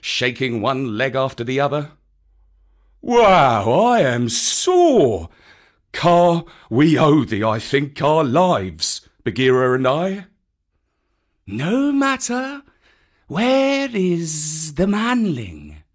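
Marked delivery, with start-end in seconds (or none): 3.0-10.3 a battered bear, loud and grateful; sore old bear. A narrator's reading. Baloo speaking, sore. 11.5-15.8 high, very slow, hissing-free snake voice; drawn-out calm serpent; cool python. A narrator's reading. Kaa speaking.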